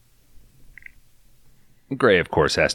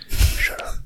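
whispering. Pretend. Whispered shush.

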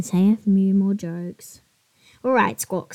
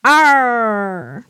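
pirate arr; 7 dB louder than usual. Acted. Pirate parrot growl.

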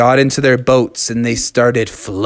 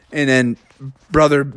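usual speaking voice

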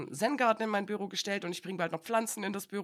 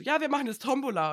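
cheerful colleague; 6 semitones higher, 5 dB louder than usual. Retold or imitated.

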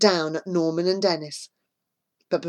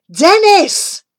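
urgent call; 13 semitones higher, 10 dB louder than usual. A narrator's reading. Sudden realisation, calling friend.

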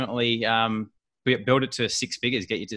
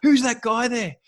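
much higher excited pointing out; imitating wife; 12 semitones higher than usual. Wife pointing out the celebrity family, high starstruck voice. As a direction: gush like a starstruck fan.

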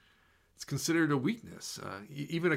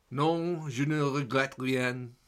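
a self-mocking attempt at a French accent; amateur French accent on the song title; slower than usual. Shown as a demonstration. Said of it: "Pardon my terrible French accent".

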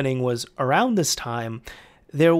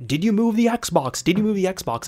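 scolding son; 6 semitones higher than usual. Retold or imitated. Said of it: "She even talked with her son".

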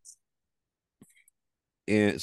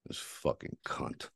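unvoiced, softer muttered curse; muttered under breath; 5 semitones lower, 11 dB quieter than usual. Real, not acted. Cursing the woman under his breath, whispered. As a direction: hiss it to yourself.

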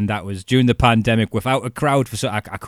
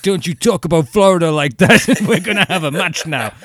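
much louder and higher cheesy wrestler comeback, laughing through the end; hammed-up hero retort; 8 semitones higher, 8 dB louder than usual. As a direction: overplay an indignant wrestling hero. Mock face answering heel, laughing.

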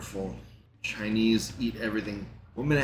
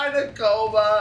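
laughing; 13 semitones higher, 4 dB louder than usual. Real, not acted. Laughing at woman spraying poison.

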